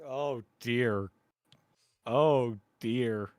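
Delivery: normal talking voice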